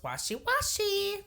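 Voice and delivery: high, drawn-out singsong chirp; buffet greeter's singsong. Retold or imitated. Filipino buffet greeter squirting sanitizer into guests' hands, high slow singsong. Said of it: "she says it just like that".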